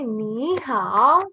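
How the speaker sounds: "ni hao" slow, going down and back up; third tone. Shown as a demonstration. Teacher models the third tone, slow dipping "ni hao". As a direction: stretch "ni hao" through a full dip. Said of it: "goes down and back up".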